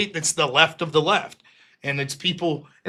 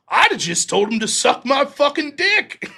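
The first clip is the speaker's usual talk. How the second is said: higher-pitched imitation of an indignant father; lightly voiced dad with raised pitch; blunt dad; 8 semitones higher, 3 dB louder than usual. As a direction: toss out dad's crude comeback, hammed up. Dad's crude blunt comeback, acted.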